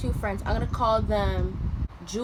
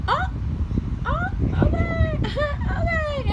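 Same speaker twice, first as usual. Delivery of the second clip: high, loud laughing okay; mocking sing-song tease; teasing friends; 5 dB louder, slower than usual. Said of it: "They're like".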